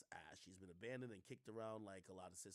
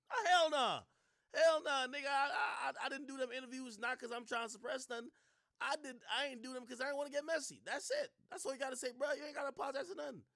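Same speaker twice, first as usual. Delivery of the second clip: much higher, loud, fired-up rant; loud indignant rant; 14 semitones higher, 10 dB louder than usual. Real, not acted. Streamer ranting at Van over apologizing, loud indignant rant. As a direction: refuse the idea with full force.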